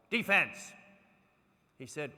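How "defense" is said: sudden loud high shout of one word; shouted team call, defense; mock yell. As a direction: bark it like a team cheer. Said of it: "We don't yell".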